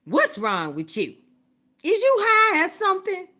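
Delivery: a mock-shocked grandma, loud and high; grandma's question. Grandma asking if she's high, loud high mock alarm. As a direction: squeal it like a startled grandmother. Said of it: "She goes".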